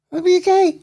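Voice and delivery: hammed-up innocent question; mock innocent surprise. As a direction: play dumb to Hammy. Cellmates playing innocent.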